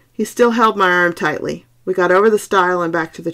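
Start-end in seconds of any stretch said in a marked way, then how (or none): none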